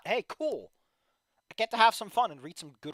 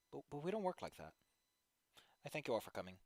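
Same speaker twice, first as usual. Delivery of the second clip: ordinary delivery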